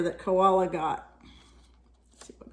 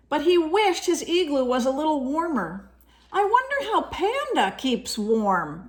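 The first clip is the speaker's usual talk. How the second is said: lively storybook reading voice; reading to children; 4 semitones higher than usual. A narrator's reading. Parent reading a picture book to a child, lively storytelling. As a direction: read it brightly to a young listener.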